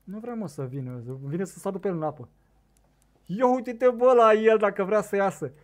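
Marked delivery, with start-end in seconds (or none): 3.2-5.5 high, loud cry of amazement; excited exclamation. Real, not acted. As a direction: call out loudly for everyone to look. Fish surfaces.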